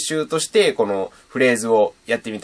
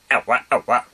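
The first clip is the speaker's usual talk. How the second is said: throaty scratched "あわ、あわ". Shown as a demonstration. Teacher demos the voice scratch for learners. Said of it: "あわ、あわっていう風に言います".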